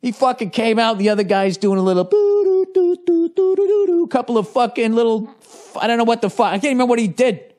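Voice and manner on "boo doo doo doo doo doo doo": high, goofy sing-song boo-doo sounds; playful higher scat of fake punches; mock fight noises. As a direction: vocalize the fighter's dancing feet. Mimicking a fighter's little moves.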